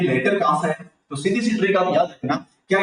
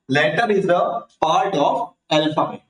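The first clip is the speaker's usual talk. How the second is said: definition repeated in measured phrases, short pauses; measured recap; much slower than usual. Shown as a demonstration. Teacher repeating the definition for children, measured recap. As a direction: say it deliberately, with only brief breaks.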